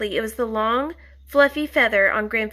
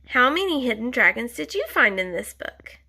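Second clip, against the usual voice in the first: ordinary delivery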